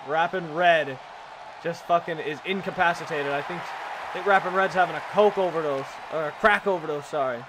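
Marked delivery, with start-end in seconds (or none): none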